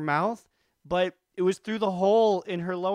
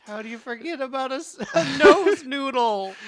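laughing; 8 semitones higher, 6 dB louder than usual. Real, not acted. Laughing disbelief at the story.

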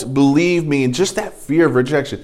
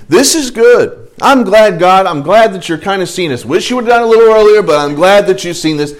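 relieved approval; 6 semitones higher, 5 dB louder than usual. Acted. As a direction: voice a mildly relieved, pleased Moses. Voices a relieved Moses to God.

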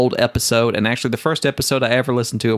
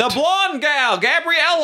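exasperated yell. Real, not acted. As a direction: snap the name out impatiently. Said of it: "don't yell at me".

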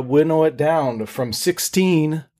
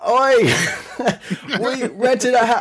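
higher, laughing attempt at an Australian accent; bad Australian accent; 9 semitones higher, slower than usual. Retelling Australians at a bar, failed Australian accent with laughter. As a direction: try an Aussie voice and crack up. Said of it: "I can't even do an Australian accent".

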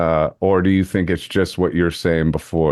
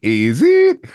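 higher, louder London "is it?"; mock London "is it?"; 9 semitones higher, 4 dB louder than usual. Host slipping the London tag into chat, higher and louder. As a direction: tease with a Cockney tag question. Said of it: "I knew I would fail this test".

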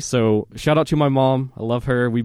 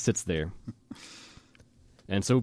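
usual speaking voice

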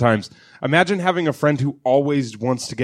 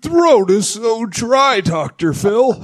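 higher, slow, wheezy dying plea; dying cartoon horse; 6 semitones higher, slower than usual. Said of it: "She sounds like a cartoon horse that's dying".

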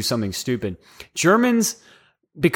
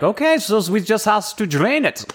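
higher-pitched, put-on German accent; 7 semitones higher than usual. German doctor explaining the procedure, mock German accent. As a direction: imitate a German lady in a higher voice. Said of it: "this really nice German lady".

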